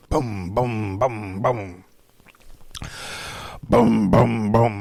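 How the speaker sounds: thump out each boom like a headache; pounding booms. Retold or imitated. Describing his pounding headache to viewers, thudding booms.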